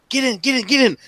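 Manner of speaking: high-pitched acted urgency; urgent hushing. Kid pushes player indoors to hide, urgent and high. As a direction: hurry them inside before they're caught.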